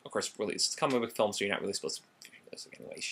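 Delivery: fast, fading into a near-whisper; hurried mumble dropping to almost nothing; muttered aside. Real, not acted. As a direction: rattle it off under your breath, trailing away. Muttered concession trailing off the rant.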